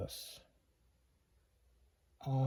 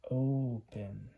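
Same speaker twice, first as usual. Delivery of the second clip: ordinary talk, nothing marked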